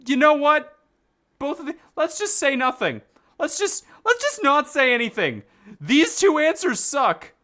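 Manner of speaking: high, loud exasperated complaint. Real, not acted. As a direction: throw up your hands annoyed. Fed up with dialogue options.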